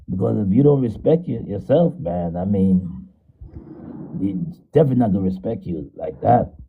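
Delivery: muffled, like through a wall. Real, not acted. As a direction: voice dull and far off. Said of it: "you sound muffled, brother".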